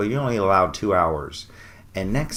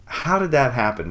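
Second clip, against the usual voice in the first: higher-pitched, lightly acted disbelief; mild mock amazement; 6 semitones higher, 3 dB louder than usual. Friend reacts to the parking luck, mildly acted amazement. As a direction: imitate a friend's surprise, pitch lifted. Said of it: "looked over at me like".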